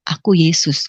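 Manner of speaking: calm, hushed answer; quoted voice of Jesus. Retold or imitated.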